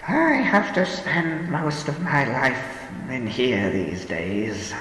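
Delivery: put-on slow, weary sighing old woman. A narrator's reading. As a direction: sigh it wearily. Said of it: "the old woman sighed".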